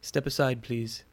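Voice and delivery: put-on understated Spanish-accented English; quiet hint of a Spanish accent; light Spanish accent. A narrator's reading. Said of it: "in a light Spanish accent".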